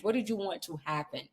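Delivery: ordinary talk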